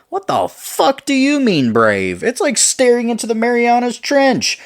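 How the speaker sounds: high, loud incredulous yelling; full-force indignant shout; exasperated outburst. Acted. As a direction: explode in disbelief, voice shooting way up. Acted outburst in a quarrel.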